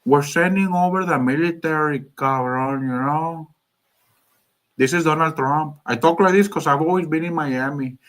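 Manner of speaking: Donald Trump as a Miami Cuban; Cuban accent. Acted. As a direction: put on a thick Cuban accent. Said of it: "he would have a Cuban accent".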